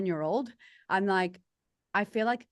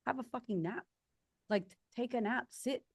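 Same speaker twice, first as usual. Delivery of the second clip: soothing; 5 semitones higher than usual. Retold or imitated. Soothing tired retreat women.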